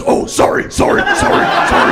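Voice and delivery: loud monster voice, frantically sorry; hammed-up monster saying sorry; apologetic monster. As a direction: blurt repeated apologies in a monster voice. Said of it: "still as a monster".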